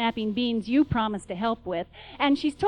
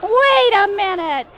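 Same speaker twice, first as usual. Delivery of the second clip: raised, quicker wait a minute; dawning surprise; 6 semitones higher, 5 dB louder than usual. Acted. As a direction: catch yourself mid-thought. Sudden realisation, excited.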